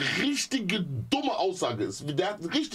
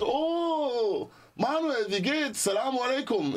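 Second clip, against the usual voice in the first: mock-delighted hello on the phone; effusive greeting; 3 semitones higher, much slower than usual. Acted phone greeting from the caller.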